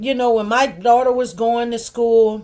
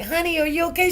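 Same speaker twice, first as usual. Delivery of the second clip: higher, concerned customer; 9 semitones higher, faster than usual. Retold or imitated. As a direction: ask with worried care. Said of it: "They're like".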